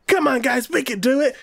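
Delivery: high, eager pep talk; high-pitched mock anime hero cheer; team rallying cry.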